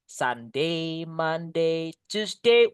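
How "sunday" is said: normal talking voice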